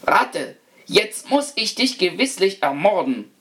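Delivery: high-pitched, hammed-up threat from the master; menacing threat. A narrator's reading. Master of the house threatens to kill the rat, hammed-up fury.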